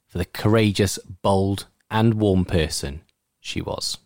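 measured, earnest tribute reading. Acted. Radio presenter reading a friend's tribute to Hayley, measured reading. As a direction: read it out low, with firm emphasis and pauses.

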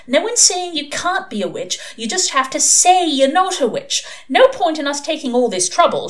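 high-pitched, exasperated reasoning; desperate. A narrator's reading. Pepper coaxing her sister to deny witchcraft, desperate. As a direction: plead with a stubborn child. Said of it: "said Pepper desperately".

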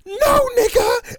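high, loud, drawn-out mock shriek; wilding girl. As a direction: squeal a refusal as the outraged woman.

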